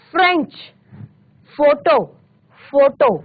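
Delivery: फ्रेंच and फोटो with फ as f; nukta f sound. Shown as a demonstration. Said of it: "नुक्ता के साथ ही करेंगे".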